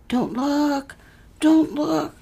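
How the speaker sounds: put-on high, frightened child's plea; a scared girl begging, pitched high; frightened cry. Little Carney begging not to be looked at, frightened cry. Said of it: "she would cry".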